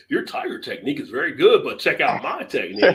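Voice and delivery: slowing on dragon style, mock grand; mock kung fu dub. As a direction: boast like a badly dubbed kung fu master. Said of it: "It's like".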